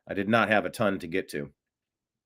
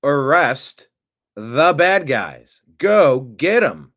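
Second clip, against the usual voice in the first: demanding shout; 3 semitones higher, 6 dB louder, much slower than usual. Retold or imitated. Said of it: "what we were screaming".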